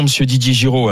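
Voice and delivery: ordinary talk, nothing marked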